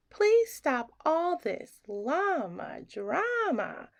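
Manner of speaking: ordinary delivery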